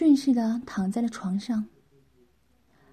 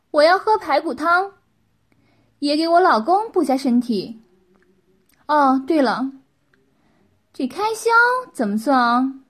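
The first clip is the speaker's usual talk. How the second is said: high, loud lady of the house; bossy wife; 4 semitones higher, 8 dB louder than usual. Acted. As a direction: announce it brightly from on high, loudly.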